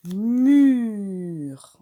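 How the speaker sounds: loud demonstrative Mur; loud model word. Shown as a demonstration. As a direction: call out Mur clearly to the class. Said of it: "On entend le mu".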